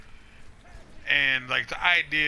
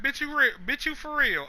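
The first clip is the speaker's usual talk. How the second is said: high, incredulous "bitch, you for real"; incredulous sass; 12 semitones higher than usual. Acted. Blaze reacting to Banthony's plan, high disbelief. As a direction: pitch way up in disbelief. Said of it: "is like".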